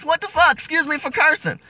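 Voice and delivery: normal talking voice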